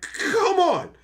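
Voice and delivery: high, loud come on; exasperated. Retold or imitated. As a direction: plead at the universe in frustration. Exasperated at mom's call.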